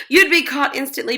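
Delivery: plain reading voice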